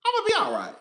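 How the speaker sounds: high, bouncy self-reassurance; high sing-song reassurance. Real, not acted. Repeats reassurance twice.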